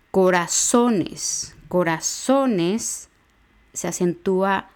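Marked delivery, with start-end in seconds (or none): 0.1-3.1 Corazones slow, with SO drawn out; stress on SO. Shown as a demonstration. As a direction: lean hard on the O of SO in Corazones. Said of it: "prosódico va en la O de so".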